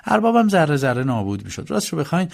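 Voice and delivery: ordinary delivery, nothing marked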